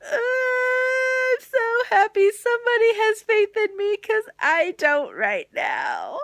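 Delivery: high, loud hammed-up grateful wail; re-enacted tearful outburst, very high; mock crying. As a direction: bawl it out in exaggerated relief. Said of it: "thinking about it is making me cry".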